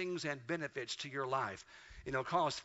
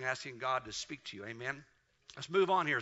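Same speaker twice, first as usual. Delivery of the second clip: ordinary talk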